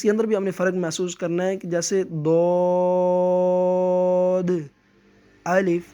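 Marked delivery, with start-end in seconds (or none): 2.0-5.8 دود drawn slowly, then a pause before الف; held letter names. Shown as a demonstration. Teacher demonstrating the difference in separate reading. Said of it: "یہ دیکھ لیں فرق بھی محسوس ہو گیا".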